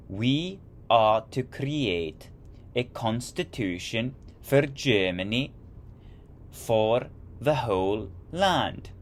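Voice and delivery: slow, stately attempt at a British accent; an amateur British accent, drawn out and grand. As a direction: read the quote slowly in a put-on British accent. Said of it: "I need to work on my accents. I can only do British".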